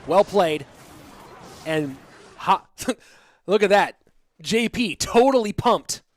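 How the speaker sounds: live play-by-play. Acted. Caster calling the match to viewers, lively play-by-play. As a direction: keep the energy of a live esports caster.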